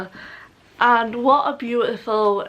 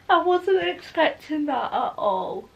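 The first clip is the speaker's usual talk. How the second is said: slow, higher, tearful with a small laugh; teary-eyed; 7 semitones higher, slower than usual. Real, not acted. Reader recovering after a sad chapter, teary. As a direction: laugh through the tears. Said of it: "I'm actually teary-eyed".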